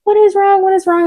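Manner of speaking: high-pitched, urgent retold question; alarmed mom. Boyfriend's mom running down the stairs, alarmed. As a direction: imitate a frantic mother, voice held high.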